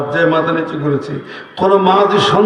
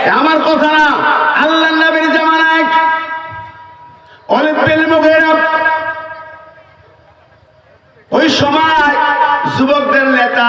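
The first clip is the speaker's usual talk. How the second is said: high, ringing sermon delivery; preaching at full force; 9 semitones higher than usual. Acted. Preacher raising his voice to the crowd, fiery sermon. Preacher starts story, raised.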